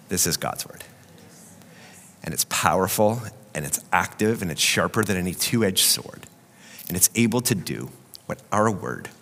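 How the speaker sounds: low, soft, earnest sermon voice; hushed preaching. Real, not acted. Preacher telling the congregation to fight fear with God's word, hushed and earnest.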